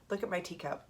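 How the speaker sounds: usual speaking voice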